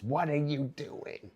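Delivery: mock a worried mother; imitated mom. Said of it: "she goes".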